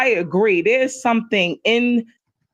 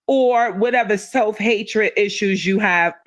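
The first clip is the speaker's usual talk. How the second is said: ordinary talk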